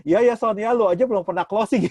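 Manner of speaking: loud, high playful ribbing; teasing. Retold or imitated. Teasing his leader on the drive home, loud and playful. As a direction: rub it in cheekily, half laughing. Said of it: "sambil ngeledek".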